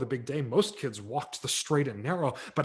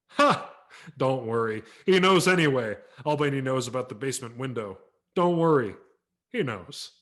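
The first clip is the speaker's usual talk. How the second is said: a touch of a mocking father, louder; teasing old man; 7 dB louder than usual. A narrator's reading. The father teasing his son about Santa, mock warning. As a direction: rib the kid about his guilt.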